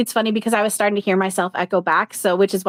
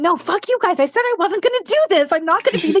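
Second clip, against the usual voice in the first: much higher, mock furious outburst at the spirits; hammed-up tantrum, pitched way up; mock angry rant; 12 semitones higher, 3 dB louder, faster than usual. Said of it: "but now I'm going".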